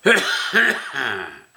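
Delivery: rough hacking smoker's cough. Real, not acted. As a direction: cough hard like a heavy smoker. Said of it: "just 'cause I'm coughing".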